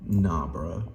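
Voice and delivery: ordinary talk, nothing marked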